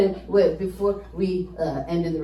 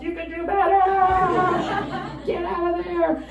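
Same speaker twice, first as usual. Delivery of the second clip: higher, urgent warning; high urgent advice; 10 semitones higher than usual. Retold or imitated. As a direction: urge them like a worried friend. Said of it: "I'm like".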